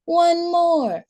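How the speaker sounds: put-on high, pleading toddler voice; whiny child plea. A narrator's reading. Maylee begging mom for a song, high whiny voice. Child begs again, higher.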